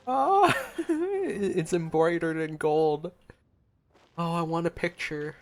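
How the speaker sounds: normal talking voice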